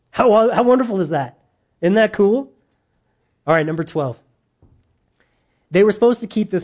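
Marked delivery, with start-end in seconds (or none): 0.1-2.5 higher and louder, delighted and amazed; excited wonder. Real, not acted. Preacher marvels to the church, excited and louder. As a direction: let the wonder burst out to the congregation.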